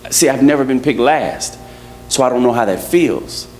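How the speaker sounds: ordinary talk, nothing marked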